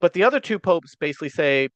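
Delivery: ordinary delivery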